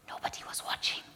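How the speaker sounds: a secretive whisper. Acted. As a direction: breathe it like a lookout checking the room. Sneaking a forbidden taste.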